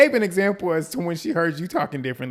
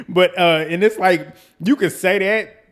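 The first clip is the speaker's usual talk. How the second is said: laughing; 5 semitones higher, 8 dB louder than usual. Real, not acted. Host laughing at the clip.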